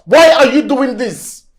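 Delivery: angry. Acted. Husband scolds wife over messy house.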